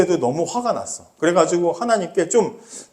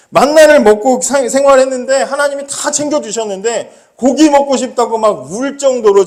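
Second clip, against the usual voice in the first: rising indignation; 5 semitones higher, 4 dB louder than usual. Acted. Preacher rises in indignation.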